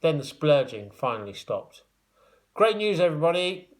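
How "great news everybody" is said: higher, louder proud announcement; announcing. A narrator's reading. George announcing good news to the foamy gang. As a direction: announce it brightly. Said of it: "announced George".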